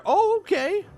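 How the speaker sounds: surprised. Real, not acted. Surprised at viewer reply.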